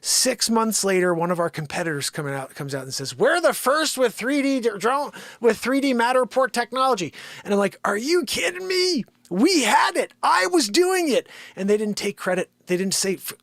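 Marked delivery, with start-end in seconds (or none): 3.2-7.1 mocking announcement. Mocking competitor's press claim. 7.8-11.3 much higher, louder, slow and exasperated; we were first, how dare they; indignant outrage. Retold or imitated. Realtor furious the competitor took credit, much higher and slow.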